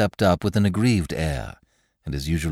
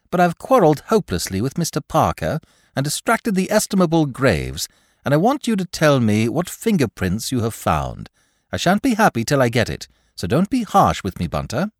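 high, louder coaxing plea; coaxing Lord Peter; 8 semitones higher, 4 dB louder than usual. Acted. Lord Peter coaxing Bunter for fingerprints, high and playful. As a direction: wheedle him playfully, high.

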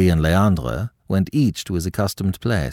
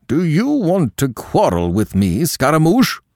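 menacing challenge, pitched up; thunderous threat; 8 semitones higher than usual. A narrator's reading. Troupe leader challenging Scaramouche at table, thunderous threat. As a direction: rumble a warning as a big angry man. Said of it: "Thunder was rumbling in his deep voice".

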